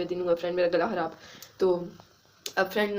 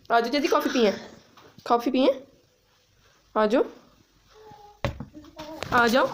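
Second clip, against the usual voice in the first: higher, louder sing-song calling to the dog; coaxing a dog to come for coffee, higher and louder; 8 semitones higher, 3 dB louder than usual. Real, not acted. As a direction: coax the puppy over, rising as he ignores you.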